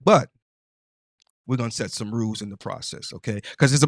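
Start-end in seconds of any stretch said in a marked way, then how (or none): none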